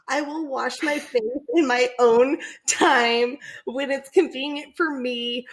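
usual speaking voice

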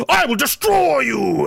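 a would-be devil straining for menace; imitating cracking Satan. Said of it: "his voice cracks like a nervous middle schooler".

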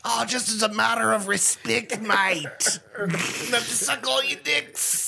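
a silly polite Aussie, slowing down; mock Australian.

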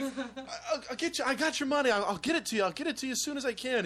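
high-pitched, flustered promises of a cornered debtor; pleading impression. Threatened dad promising the collector money, mock frightened pleading.

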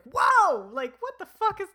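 high, loud mock-alarmed outburst; hammed-up shocked protest; startled objection. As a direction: slam the brakes on in sudden alarm. Acts character suddenly halting the makeout.

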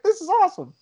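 very high, excited squeal of a quote; mock-amazed, high voice; retold delight. Retells amazed language return.

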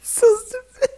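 very high, tearful laughing squeak; helpless laughter. Real, not acted. Still cracking up at the stupid joke, real tearful laughter. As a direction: try to talk while weeping with laughter.

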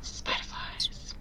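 hushed library whisper. Acted. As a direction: breathe it out, barely voiced.

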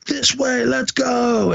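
hammed-up weekend soldier rallying his men; mock officer command. National Guard leader rallies troops.

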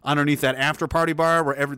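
ordinary delivery

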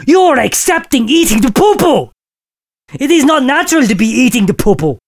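usual speaking voice

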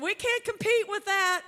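high, loud laughing exclamation; laughing quote. Retold or imitated. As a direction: burst out laughing as you say it. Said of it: "They said".

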